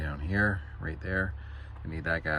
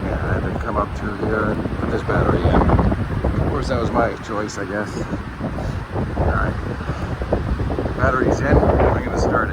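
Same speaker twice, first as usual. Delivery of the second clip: loud, raised voice outdoors; shouting over wind; 4 semitones higher, 10 dB louder than usual. Real, not acted. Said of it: "Hopefully you can hear me out with this wind".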